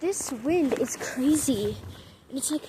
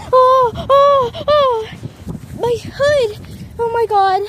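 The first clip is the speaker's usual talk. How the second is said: high, loud panicked yelps; panicked cries; 9 semitones higher, 9 dB louder, slower than usual. Real, not acted. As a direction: cry out as wind grabs you.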